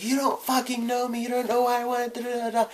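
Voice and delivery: high, fast mock-angry outburst; retold yelling. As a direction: fire it back like a furious kid. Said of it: "I was, like, yelling".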